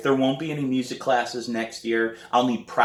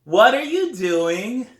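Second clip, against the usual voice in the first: put-on high, slow kid's nagging question; little brother voice; 13 semitones higher, 7 dB louder, slower than usual. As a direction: play the pestering little brother, pitched way up. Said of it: "says, yet again".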